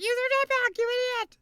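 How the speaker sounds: very high, loud exasperated scream; full-voiced frustrated shriek, reenacted. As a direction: scream it at the character on screen. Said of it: "I was screaming that".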